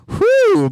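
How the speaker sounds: high, cracking exhale of a whew; a strained whew as the voice breaks. Real, not acted. Announcer feels his voice cracking on a whew. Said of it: "my voice is cracking already".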